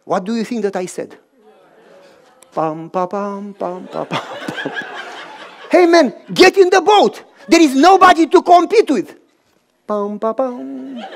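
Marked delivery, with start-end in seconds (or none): none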